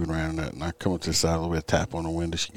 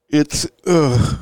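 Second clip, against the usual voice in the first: words stretched through a yawn; 9 semitones higher, 9 dB louder than usual. Real, not acted. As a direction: talk through a big yawn. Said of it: "I don't know why I'm so yawny tonight".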